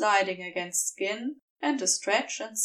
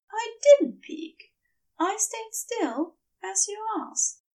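Aziraphale answering in a high, meek voice; meek angel voice; 10 semitones higher than usual. Acted. Aziraphale answers Crowley, submissive.

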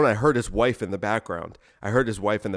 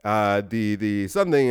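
put-on Indian accent with hesitant stops and starts; rough Indian accent. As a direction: imitate a landlord fumbling directions in an Indian accent. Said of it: "am I doing an Indian accent?".

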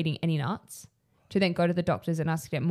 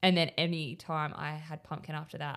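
ordinary talk, nothing marked

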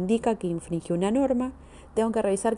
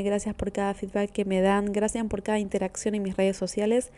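ordinary talk

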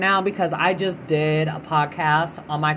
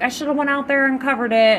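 cousin quoted; 8 semitones higher, 4 dB louder, faster than usual. Retold or imitated. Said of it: "she's like".

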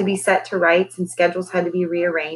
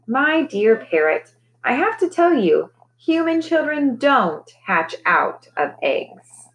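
amused magician; 6 semitones higher, slower than usual. Acted. Magician gently explaining to parrot.

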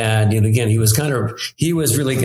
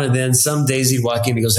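ordinary talk, nothing marked

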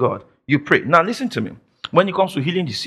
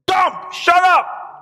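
slow, high, commanding angel's rebuke; stern rebuke; 13 semitones higher, much slower than usual. Acted. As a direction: order him to silence, drawn out.

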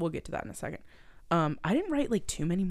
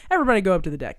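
louder, barked order; mock shout; 3 semitones higher, 9 dB louder, much faster than usual. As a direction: call it down the corridor. Crew banging on lower-class doors.

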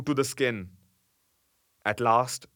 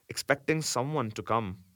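usual speaking voice

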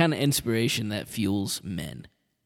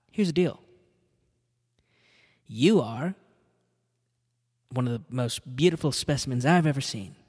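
pushy Hollywood agent; 4 dB louder than usual. Retold or imitated. An agent hyping up Arnold about acting, loud and eager. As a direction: sell him on acting like a slick agent.

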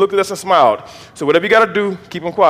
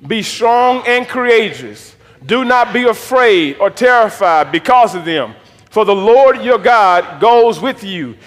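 slow, raised-pitch, emphatic Bible reading; scripture read-aloud; 4 semitones higher, 3 dB louder, slower than usual. Acted. Preacher reading Deuteronomy with the congregation, slow and declaring. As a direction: declare each phrase slowly.

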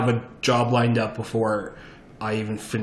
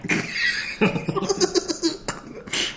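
high airy laugh; breathy laugh; 3 dB quieter than usual. Real, not acted. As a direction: laugh softly under breath. Laugh after greeting.

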